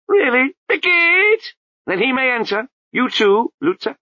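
a high babbling abbot voice, breaking into nonsense; abbot's voice. A narrator's reading. The abbot scolds the crowd, high babbling voice.

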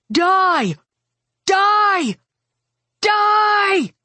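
high, sustained screams, one after another; furious high-pitched battle cries; screamed. A narrator's reading. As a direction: scream each word like a spell meant to kill. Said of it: "I screamed".

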